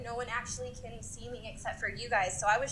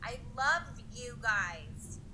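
slow, drowsy stoner drawl; lazy, high and spaced out; stoned; slower than usual. Real, not acted. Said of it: "I'm really high right now".

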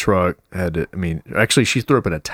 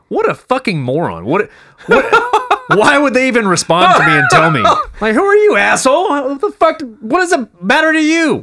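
mock outraged reply; 6 dB louder than usual. Imagined rude reply, laughing.

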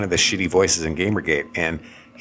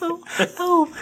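much higher, perky put-on feminine voice; mock girly voice. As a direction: chirp it like a forced bubbly girl. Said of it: "That's how it started".

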